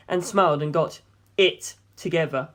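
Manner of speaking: ordinary delivery, nothing marked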